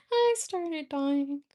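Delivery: high, loud, drawn-out amusement; laughing. Real, not acted. Telling viewers how funny the comments were, laughing. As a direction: say it slowly through high laughter.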